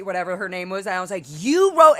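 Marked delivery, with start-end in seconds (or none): 1.3-2.0 loud, much higher call-out; loud public accusation. Retold or imitated. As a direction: announce it to the whole room. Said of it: "I said it out loud to everybody".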